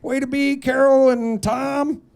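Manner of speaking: high, sing-song cake-shop cheer; cheery baker. Retold or imitated. Baker reading out cake messages, high and cheery. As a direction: chirp it like a happy baker writing on a cake.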